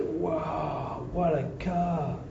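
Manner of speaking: lightly voiced, slow and impressed; a hint of gushing praise to flatter the owner; admiring wow. Preacher acting a man admiring a BMW.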